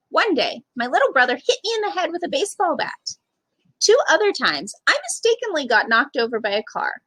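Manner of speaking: ordinary delivery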